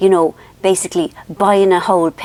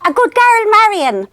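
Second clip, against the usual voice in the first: put-on high, louder sing-song praise from an old mammy; imitating mother; 13 semitones higher, 4 dB louder than usual. Mother finally praising her daughter, high mock mammy voice. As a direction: pat her on the head with your voice.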